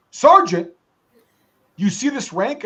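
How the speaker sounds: high, loud, offended demand; indignant sergeant. Retold or imitated. Master sergeant scolding the soldier, high indignant bark. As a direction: point at your rank and demand respect. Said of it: "And he goes".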